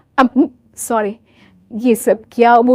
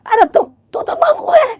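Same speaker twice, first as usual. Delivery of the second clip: put-on old mother-in-law; 10 semitones higher than usual.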